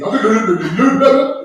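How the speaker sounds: mush-mouthed, high, loud stammer; Shannon Sharpe. Acted. Sports host naming lemon pepper, big-lipped mumbling impression. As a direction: garble it as if through very big lips. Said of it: "talks like his lips are very big".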